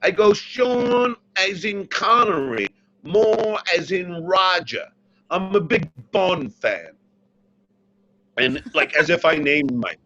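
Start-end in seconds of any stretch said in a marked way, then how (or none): 0.3-6.9 put-on English accent. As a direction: spell out the name slowly in a mock English accent. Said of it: "I called with an English accent".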